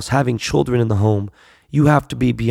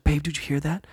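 imitating his wife, hushed and nervous; a soft worried whisper in the dark; hushed startled wife; 3 semitones higher, 8 dB quieter than usual. Wife hearing noises outside, hushed and startled.

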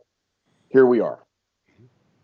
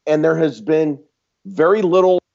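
ordinary delivery

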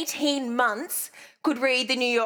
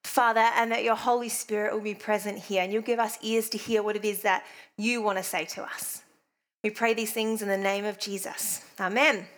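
prayer; 3 semitones lower than usual. Acted. Opening prayer before sermon.

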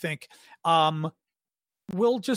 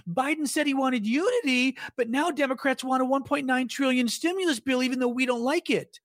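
mocking Republican voice; 7 semitones higher than usual. Mocking Republican talking point.

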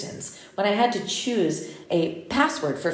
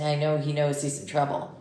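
ordinary delivery

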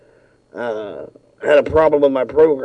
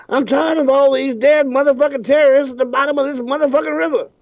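Samuel L. Jackson voice; 10 semitones higher than usual. Pretend. Jackson commercial impression.

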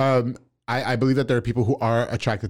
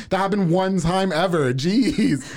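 exasperated; 6 semitones higher, slower than usual. Retold or imitated. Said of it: "And I'm like".

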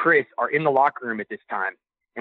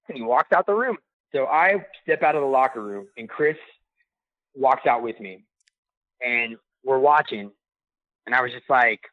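usual speaking voice

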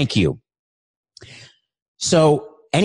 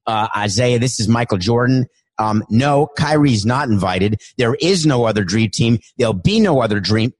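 lower, firm Jordan voice; Michael Jordan; 3 semitones lower than usual. Acted. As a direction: shut it down flatly, low and dry. Michael Jordan impression, firm refusal.

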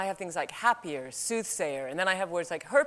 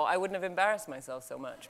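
normal talking voice

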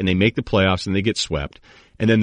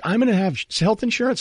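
higher mock-eager voice; hopeful staffer; 12 semitones higher than usual. Comcast coworkers dreaming of benefits, mock-eager higher voice. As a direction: beg for it like a desperate staffer. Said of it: "everybody's waiting around me like".

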